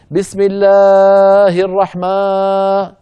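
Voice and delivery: الله chanted with a hugely drawn-out long vowel, high and loud; wrongly overstretched recitation. Shown as a demonstration. Teacher mimics brothers' faulty recitation, الله overstretched. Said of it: "بيجي بعض إخواننا بيقول لك".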